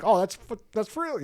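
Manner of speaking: high mock bro voice, pitched well up; chatbot bro voice. Imitating his chatbot talking like a bro, high mock voice. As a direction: play the chatbot as a casual bro, squeaky and high. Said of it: "he's like".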